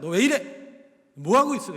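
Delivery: loud imitation of a reprimand; mock scolding. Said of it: "책망하지 않으세요".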